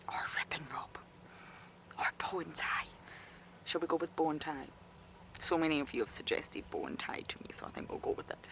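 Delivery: hushed whisper so the cubs stay calm. Real, not acted. Keeper on a den livestream, whispering names to viewers.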